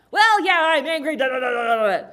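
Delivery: high, very loud angry rant; pissed-off teenager. Acted. Teenage daughter to validating parent, acted angry yelling. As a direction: yell back, dissolving into furious babble. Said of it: "when she's all pissed off".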